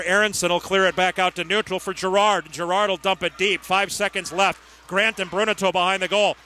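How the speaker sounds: usual speaking voice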